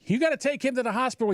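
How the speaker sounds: high, fast imitation of the neighbor; urgent neighbor. Neighbor urging his dad toward the hospital, high hurried urgency.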